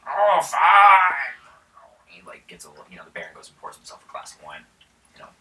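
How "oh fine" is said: high, loud wailing complaint; a wounded peasant grudgingly gives in; hammed-up cry; whiny peasant.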